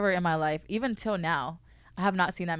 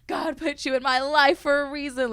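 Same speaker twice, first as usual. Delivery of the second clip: retold tearful cry; 7 semitones higher, 5 dB louder than usual. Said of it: "I just, like, broke down crying".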